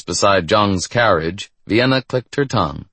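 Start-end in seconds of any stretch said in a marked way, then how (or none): none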